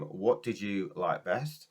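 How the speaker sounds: normal talking voice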